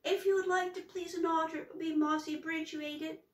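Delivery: high, fast, tumbling flustered words; panicked garbled troll voice; flustered jumble. Acted. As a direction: rush it out in a panic. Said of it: "it came out all upside down".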